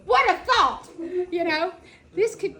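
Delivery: loud, high laughing exclamation. Real, not acted. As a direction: laugh at the idea.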